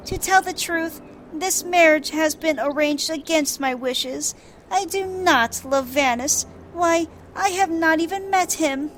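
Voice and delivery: a mild sad confession; Arabella voiced.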